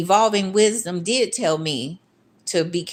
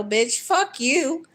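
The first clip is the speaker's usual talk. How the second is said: higher and slow, spitting it at them; slow angry outburst; 7 semitones higher, slower than usual. Real, not acted. To old followers who doubted her, slow high furious snap. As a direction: drag out a furious higher retort.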